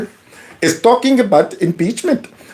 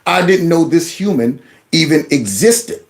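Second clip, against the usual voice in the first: normal talking voice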